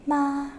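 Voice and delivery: "Ma" held on one even pitch; level tone. Shown as a demonstration. Teacher showing third tone to class, unchanging level "Ma". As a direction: sustain "Ma" with no change in the voice. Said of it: "same inflection throughout".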